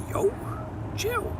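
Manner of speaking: very high squeak on Chill; squeaky chill. Acted. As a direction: squeak it like a wounded kid. Mock outrage at a hydro pump.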